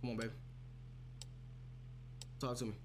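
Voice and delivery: ordinary talk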